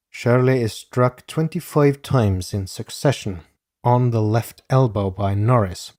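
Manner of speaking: normal talking voice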